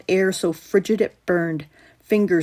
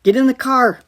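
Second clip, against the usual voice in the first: loud, high sharp order; big brother giving an order; commanding; 6 semitones higher, 7 dB louder than usual. A narrator's reading. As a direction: take charge. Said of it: "Ryan commanded".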